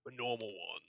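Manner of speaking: halting explanation, long pauses between phrases; nervous orc voice. Acted. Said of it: "having to stop that voice".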